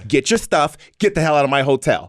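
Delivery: lightly voiced sassy manager, fast at the end. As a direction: shoo them out, quick and sassy. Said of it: "This is how, how he sounds".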